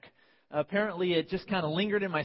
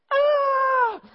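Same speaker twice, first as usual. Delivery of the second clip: sudden high, loud scream; re-enacted pain scream; 11 dB louder than usual. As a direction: shriek as if cut without warning. Acts out scream at second procedure.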